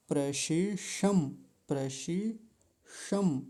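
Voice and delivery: प्रशिषम slow, श then ष distinct; careful प्रशिषम. Shown as a demonstration. Teacher to students, carefully demonstrating प्रशिषम. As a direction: sound both sibilants of प्रशिषम slowly. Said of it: "देखिए ध्यान से".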